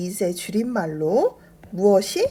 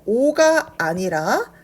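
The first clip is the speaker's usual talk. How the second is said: ordinary talk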